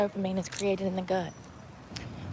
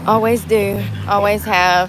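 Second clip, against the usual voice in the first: defiant; 9 dB louder, slower than usual. Real, not acted. Defiant self-assertion.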